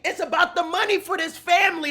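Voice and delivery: ordinary delivery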